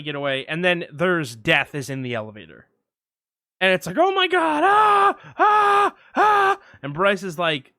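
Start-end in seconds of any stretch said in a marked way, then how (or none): 4.0-4.6 very high-pitched frightened cry; hammed-up panic, voice shooting way up; mock terror. Podcaster acting out meeting Death in the elevator, mock terror. 4.6-6.6 loud, high, repeated shrieks; hammed-up screaming in short bursts; mock terrified screams. Podcaster imitating screams at Death in the elevator, mock terror.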